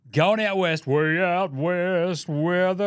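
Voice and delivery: loud, drawn-out announcer call; announcer drawl. Pretend. Podcaster intros the game like a broadcaster, drawn out. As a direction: boom it like a broadcaster, slowly.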